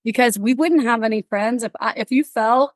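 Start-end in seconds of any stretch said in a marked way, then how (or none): none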